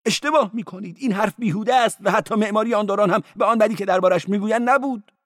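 high-voiced cleric. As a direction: play the cleric high and quick. Cleric contradicting.

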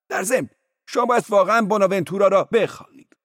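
loud cleric. Acted. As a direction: say it out loud to the caller. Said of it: "با صدای بلند گفت".

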